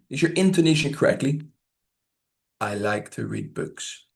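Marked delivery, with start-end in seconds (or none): 2.6-4.1 slow, soft, low and toneless; flat monotone. Shown as a demonstration. Teacher showing unconvincing intonation, flat monotone. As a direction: drone it as if you don't care. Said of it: "Flat, monotone speech".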